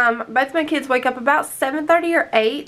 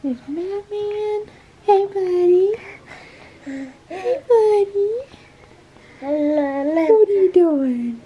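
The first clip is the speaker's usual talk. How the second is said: pet talk; 3 semitones higher, slower than usual. Real, not acted. Cooing to pet on camera.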